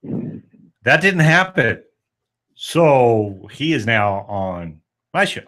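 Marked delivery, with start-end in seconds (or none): none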